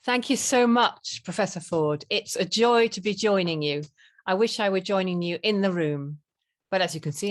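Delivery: ordinary delivery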